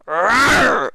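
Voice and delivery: a short, loud growl of exasperation; frustrated growl. Real, not acted. Streamer growling in frustration at the news, loud. Frustrated outburst mid-rant.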